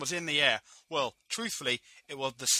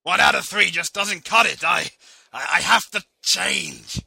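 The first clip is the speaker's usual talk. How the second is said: loud resolve; 10 dB louder than usual. Acted. Vince resolves loudly.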